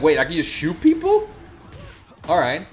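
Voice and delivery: loud, eager "Wait, I can just shoot people"; suddenly thrilled by the idea; excited realization. Retold or imitated. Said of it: "He's like".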